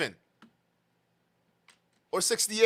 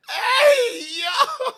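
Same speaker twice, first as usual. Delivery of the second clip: high, loud shout through laughter; laughing whoop; 9 dB louder than usual. Real, not acted. Host reacting to the bedroom joke, shouting through real laughter. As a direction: whoop it out while cracking up.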